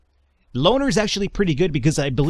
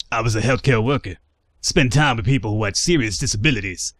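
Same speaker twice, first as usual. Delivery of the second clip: tough guy voice. Acted. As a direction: talk like a hard man from the streets. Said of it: "a tough guy voice here".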